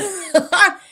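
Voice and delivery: high burst of laughter. Real, not acted. Bursts out laughing about her room.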